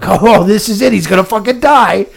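gleefully expecting a death, half joking; mock gleeful. Buddy on a podcast recalling a politician's illness, hammy glee. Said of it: "I was like".

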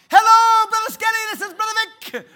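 very high, loud, bursting with excitement; excitable Brother Vic. Retold or imitated. Imitating Brother Vic on the phone, high and loud. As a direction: boom it out like the most excitable guy alive. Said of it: "On the other end of the phone".

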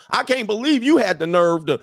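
outraged mock tirade; mock furious woman. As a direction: play her going nuts, hammed up. Acts woman smashing windows in rage.

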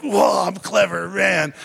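loud, pleased-with-himself exclamation; mock smug boast. Preacher mimicking a self-satisfied man, mock smug. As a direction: play the man proud of his straw trick.

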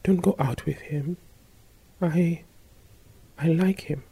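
low, soft, hesitant confession; quiet. Acted. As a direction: say it softly, almost afraid. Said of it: "uttered these words lowly".

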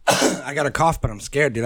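ordinary talk

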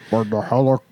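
marbles in mouth. Pretend. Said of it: "You're only doing it worse".

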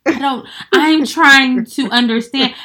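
pleading patience. Retold or imitated. To a confusing boyfriend, slow pleading. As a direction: spell it out slowly, begging to get it. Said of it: "I'll be like".

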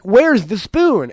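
put-on high, loud, slow kids'-show host; mock clueless presenter question; Steve. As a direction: ask as if to toddlers. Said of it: "when Steve is like".

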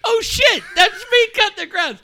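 loud, squealing mock realisation; very high voice breaking with laughter; hysterical retelling. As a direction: shriek it out while cracking up. Hysterical retelling of friend's blunder.

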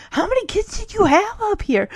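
a hint of suspicious friend; prying Kate. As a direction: needle her with a sly high voice. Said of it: "this is where Kate's like".

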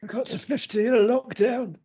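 high-pitched mock old-timer; comic high voice, playfully put on; silly put-on voice. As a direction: complain about being old in a silly high voice. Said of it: "in that voice".

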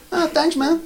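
put-on high, naive kid voice taking it literally; imitating his kid. Comedian voicing his son thanking him, high and naive. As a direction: play the clueless kid, pleased. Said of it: "weet je wat mijn kind denkt".

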